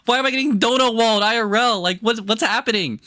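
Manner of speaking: high-pitched mock meltdown; exasperated squeal. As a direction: squeak it out like you're losing it. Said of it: "And I'm like".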